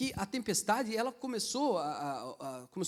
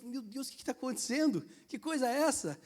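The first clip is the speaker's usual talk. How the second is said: higher astonished wonder; amazed Peter; 6 semitones higher than usual. Retold or imitated. Peter stepping on the waves, astonished. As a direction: marvel at walking on water. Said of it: "você anda mais um pouquinho e sente".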